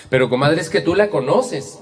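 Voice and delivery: high, loud plea to be understood; acted-out self-justification; defensive protest.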